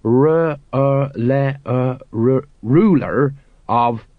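sounding out each letter separately; slow, labored reading. A narrator's reading. Sergeant Colon struggles with the scroll, reading haltingly. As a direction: piece the word together bit by bit. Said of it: "he read slowly".

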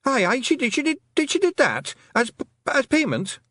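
insisting in a fluster; babbling Feegle. Acted. Rob Anybody babbling.

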